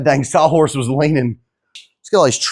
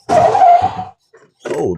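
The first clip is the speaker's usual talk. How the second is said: high yelp of surprise as the brakes grab; sudden braking startles him into a high oh; startled oh; 4 dB louder than usual. Real, not acted. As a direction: caught off guard by sudden brakes. Brakes lock up hard.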